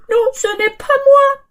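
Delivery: high, fast little bird; bird's denial. Acted. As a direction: protest innocence like a tiny bird. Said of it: "dit l'oiseau".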